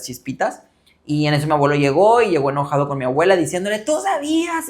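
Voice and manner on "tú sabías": higher, pleading accusation; a retold tearful drunk's reproach; mock drunk grandpa.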